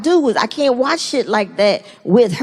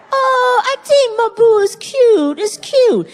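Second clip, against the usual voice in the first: wife cooing; 9 semitones higher, slower than usual. Retold or imitated. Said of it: "My wife is like".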